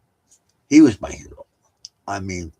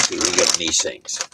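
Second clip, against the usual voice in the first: usual speaking voice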